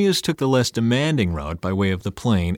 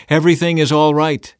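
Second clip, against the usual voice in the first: raised, higher call; crying out; 5 semitones higher, 5 dB louder than usual. A narrator's reading. Messenger announces victory to the king, crying out. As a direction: call out the good news. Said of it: "cried out to the king".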